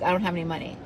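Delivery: small, low, soft mock excuse; meek excuse. Friend answers an offer of abundance, meek and quiet. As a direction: mimic a feeble excuse, hushed and low. Mimics people turning down her offer.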